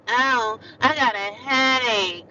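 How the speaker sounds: slow, high put-on headache whine; fake sick voice. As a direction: play up a pretend headache. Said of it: "She trying to tell the staff member she got a headache".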